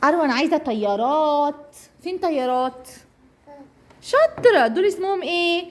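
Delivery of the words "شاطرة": high, loud squeaky praise; squeaky praising a dog. Real, not acted. Praising a toddler.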